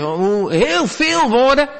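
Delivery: high, drawn-out mock awe; hammed-up pagan praying; mock heathen praying. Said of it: "Dat was natuurlijk het heidense bidden".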